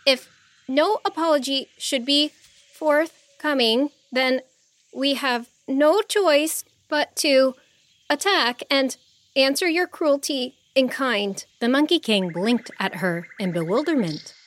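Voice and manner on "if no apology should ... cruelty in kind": put-on a higher, formal young girl making a demand; nervous but proud, stumbling a little; young princess voice.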